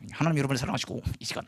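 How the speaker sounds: preaching with the jaw locked tight; through clenched teeth. Shown as a demonstration. Missionary preaching to villagers while keeping bugs out, teeth clenched. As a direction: say it without parting the teeth. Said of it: "이를 악물고 이해하게 설교하는".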